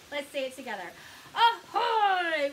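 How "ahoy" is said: loud, high hail with a stretched end; pirate ahoy. Shown as a demonstration. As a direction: call out for children to join in. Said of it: "Can we say ahoy again together".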